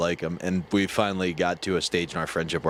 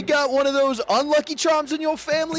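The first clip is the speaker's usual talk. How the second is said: very high put-on voice; high-pitched mock banter; imagined comedian impression; 6 dB louder than usual. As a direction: squawk it as the teasing comedian. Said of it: "he'd look at your last name and be like".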